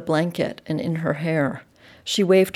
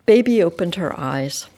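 plain reading voice

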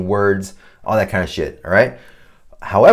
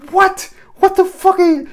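much higher, disbelieving cry; incredulous outburst; 5 dB louder than usual. Retold or imitated. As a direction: squeal in shocked disbelief.

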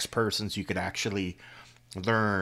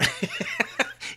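laugh. Real, not acted. Laughs at forgotten shotgun.

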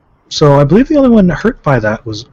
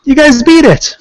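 high, loud victory call; excited; 13 semitones higher, 5 dB louder than usual. Real, not acted. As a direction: cheer the party on. GM announces victory.

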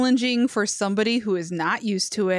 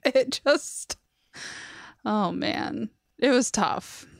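ordinary talk

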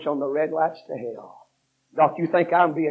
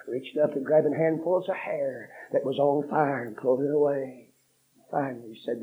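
ordinary talk

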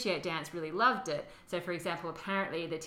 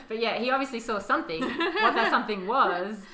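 laughing; 5 semitones higher, 8 dB louder than usual. Real, not acted. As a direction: laugh as you say it. Laughing at the vague quote.

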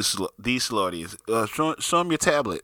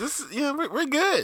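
mock high voice. Joking about lost sound.

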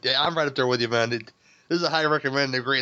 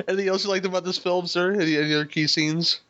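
usual speaking voice

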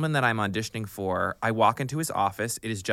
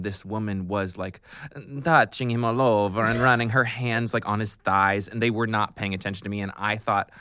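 usual speaking voice

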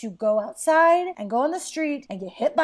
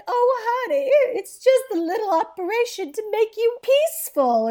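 much higher, syrupy mom voice; sugary mother; 8 semitones higher, 3 dB louder than usual. Retold or imitated. Mother brushes off the operation, high and sugary. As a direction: coo it like a fussing mother.

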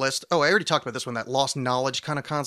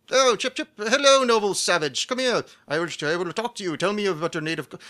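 loud, high, hammed-up colonial British toff; blustering mock-British greeting, pitched high; botched British accent; 5 semitones higher, 4 dB louder than usual. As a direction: overdo a terrible British explorer accent. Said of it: "I have no idea what accent that was".

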